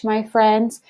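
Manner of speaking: normal talking voice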